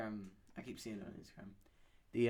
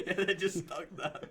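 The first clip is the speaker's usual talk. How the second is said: laughing; 7 semitones higher, 7 dB louder than usual. Real, not acted. Laughing at joke.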